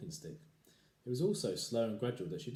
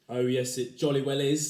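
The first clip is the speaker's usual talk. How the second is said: taunting husband; 4 semitones higher, 11 dB louder than usual. Acted.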